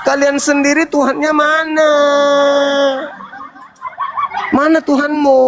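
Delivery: high, shouted demand; challenging shout. Real, not acted. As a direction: yell the question at them.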